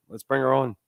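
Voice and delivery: ordinary talk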